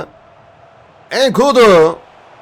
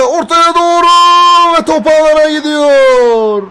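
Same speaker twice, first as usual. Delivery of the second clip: excited commentator; 4 semitones higher, 3 dB louder than usual. Real, not acted. Goal goes in.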